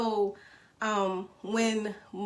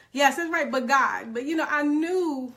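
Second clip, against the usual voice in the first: ordinary delivery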